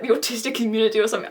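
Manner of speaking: ordinary talk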